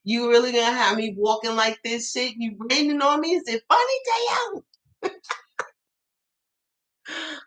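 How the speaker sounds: pissed off, talking back to the sky; mock angry at the sky. Soaked mom yelling up at the rain, mock outrage. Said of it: "so pissed off looking at the sky".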